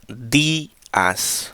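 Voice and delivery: días drawn out, power on the first syllable DÍ; stress on días. Shown as a demonstration. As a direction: push the accented í in días, then let "as" fall. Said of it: "we do the power here".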